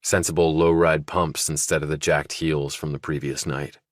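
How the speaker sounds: usual speaking voice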